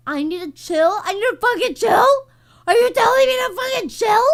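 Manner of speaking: mock outrage. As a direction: build the fake outrage line by line. Indignant at friend.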